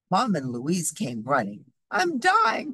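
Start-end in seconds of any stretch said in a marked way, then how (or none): 1.9-2.7 high, melodramatic wail; storybook cry of mock agony. A narrator's reading. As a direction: overplay it like a fussing patient. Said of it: "cried Fox".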